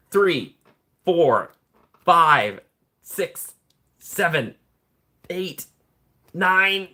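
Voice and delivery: high, loud counting; excited counting. Real, not acted. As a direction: count them off with rising excitement. Counting gift memberships aloud.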